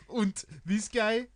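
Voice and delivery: high, loud, hammed-up German accent; camp German Klaus. Imitating Klaus from the sitcom in camp German. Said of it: "It's like".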